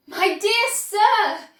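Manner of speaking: high, loud, slow exclamation; cried out. A narrator's reading. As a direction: sing it out grandly. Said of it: "cried Mister Wonka".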